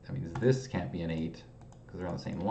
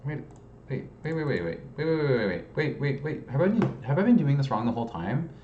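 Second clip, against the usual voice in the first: higher, urgent string of waits; alarmed realisation; 6 semitones higher, 5 dB louder, faster than usual. Real, not acted. Solver spotting their own mistake, startled and high. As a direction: catch yourself in a sudden panic.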